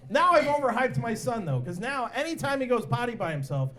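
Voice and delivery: usual speaking voice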